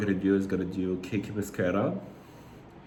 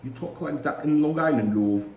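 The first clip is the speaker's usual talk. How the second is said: higher-pitched attempt at Long Island turning British; accidental British accent; 6 semitones higher, 3 dB louder than usual. Shown as a demonstration. Trying Long Island talk and landing in British accent. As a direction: put on a hammy British lilt. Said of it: "No, that's British".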